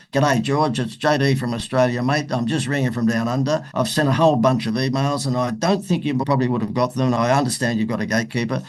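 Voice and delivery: put-on lightly done broad Australian Crocodile Dundee accent. Leaving a phone message for a Hollywood manager, mild Crocodile Dundee accent. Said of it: "I put the best Paul Hogan Crocodile Dundee accent on".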